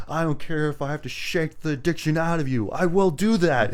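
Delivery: hammed-up determination; mock fierce vow. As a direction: imitate a hero vowing hard, building in volume. Acts hero shaking addicted kid.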